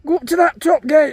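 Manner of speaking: loud order to the cattle; calling out. Real, not acted. Farmer calls to his cows to go up to the gate. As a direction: call it across the field.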